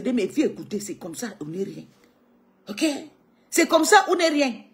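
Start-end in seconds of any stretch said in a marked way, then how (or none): 3.5-4.6 quoting Thérèse. Retold or imitated. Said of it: "Thérèse ... elle a dit".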